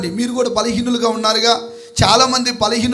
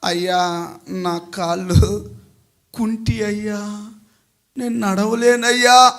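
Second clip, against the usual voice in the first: low, soft, slow plea; acted feeble excuse, voice dropped; helpless lame man; 4 semitones lower, 5 dB quieter, slower than usual. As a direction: plead weakly, haltingly. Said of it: "అన్నప్పుడు ఈడేమనాలా?".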